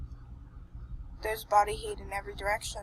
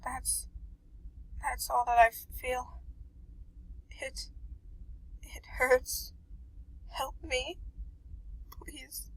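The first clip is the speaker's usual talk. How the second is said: weak pained whisper; 4 semitones higher, 6 dB quieter, slower than usual. Acted. Injured, freezing cat pleading.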